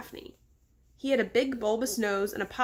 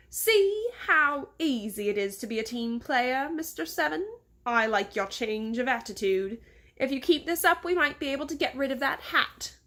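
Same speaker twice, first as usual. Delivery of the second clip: put-on higher, English-accented condescending praise; patronizing English boss; 3 semitones higher than usual. Boss rewards obedient Mister Seven, patronizing English accent. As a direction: praise your servant as if a child, English accent.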